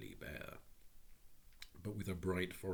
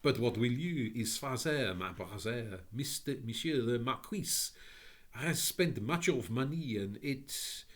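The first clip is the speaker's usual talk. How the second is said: put-on high, French-accented lady's welcome; 5 semitones higher, 6 dB louder than usual. A narrator's reading. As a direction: greet them in a lilting French accent. Tearful French lady explains.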